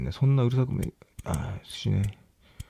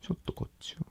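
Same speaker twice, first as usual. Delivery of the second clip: scared whisper; 9 semitones higher, 16 dB quieter, faster than usual. Real, not acted. Scared, quiet at night.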